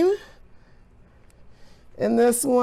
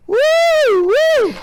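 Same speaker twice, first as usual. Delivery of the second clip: excited whoop; 6 dB louder than usual. Real, not acted. Cheering the experiment.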